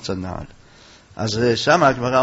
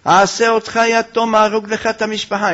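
high-pitched, insistent menace; acted threat; 6 semitones higher than usual. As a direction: press the threat on him, voice raised. Woman threatens his family.

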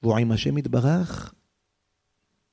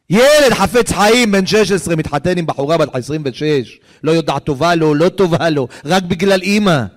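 loud, raised climactic cry; impassioned preaching; 3 semitones higher, 7 dB louder than usual. A narrator's reading. Preacher amazed at a boy marrying for his mother, impassioned shout. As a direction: hammer each phrase out to the crowd.

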